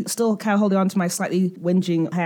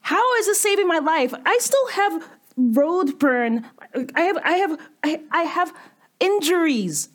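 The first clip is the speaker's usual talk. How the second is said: indignant; 6 semitones higher than usual. Acted. Indignant character complaining.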